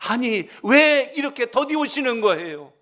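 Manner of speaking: a frantic father yelling at the surgeon; angry shout. Retold or imitated. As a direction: bark the blame at the late doctor. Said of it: "소리를 지르는 거예요".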